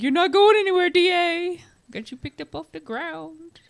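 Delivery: high coaxing voice to a turtle; baby-talking a pet; cutesy pet voice. Retold or imitated.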